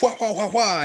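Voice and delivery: high yelping war cry; leaping battle cry. Retold or imitated. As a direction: yelp it while leaping on. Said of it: "you're like".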